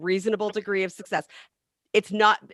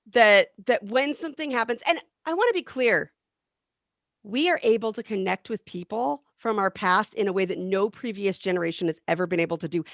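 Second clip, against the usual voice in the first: ordinary talk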